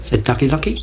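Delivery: ordinary delivery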